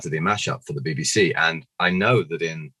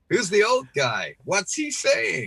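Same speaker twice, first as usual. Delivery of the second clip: put-on high, slow puzzled kid voice; mock kiddie bewilderment, drawn out; imitated child viewers; slower than usual. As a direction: whine it slowly up high like a baffled child.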